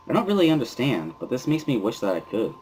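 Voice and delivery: usual speaking voice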